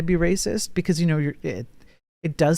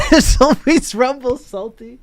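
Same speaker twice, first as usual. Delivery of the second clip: said through a laugh, higher and louder; 9 semitones higher, 5 dB louder than usual. Real, not acted. Streamer laughing while repeating a chat joke, laughing. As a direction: laugh out the line again, amused by the joke.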